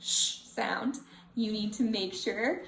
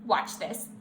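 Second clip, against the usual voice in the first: ordinary delivery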